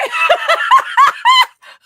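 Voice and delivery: high, loud burst of a laugh; a sudden shrill cackle of disbelief; shrieking laugh. Real, not acted. Bursts out at his text.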